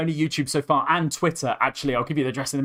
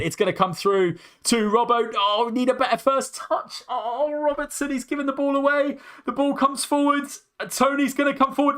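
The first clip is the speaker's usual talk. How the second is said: much higher, shouting the action as it unfolds; frantic excited commentary; 12 semitones higher than usual. Real, not acted. Fan commentating a goalmouth scramble for viewers, frantic high excitement. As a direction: ride the scramble, voice up high and racing.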